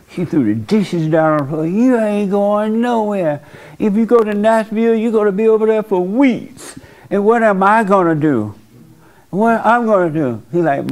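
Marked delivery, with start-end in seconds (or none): 1.7-10.4 upset mama. Retold or imitated. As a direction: play the clingy mother begging her son to stay. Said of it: "the mama had a hissy fit".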